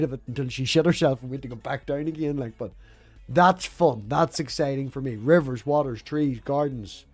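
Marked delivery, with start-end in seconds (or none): none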